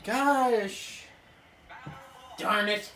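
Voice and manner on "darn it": usual speaking voice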